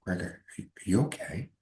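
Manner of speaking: concerned director. Retold or imitated. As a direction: ask gently like a worried teacher. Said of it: "he's like".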